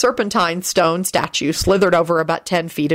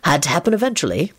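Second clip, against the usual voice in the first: usual speaking voice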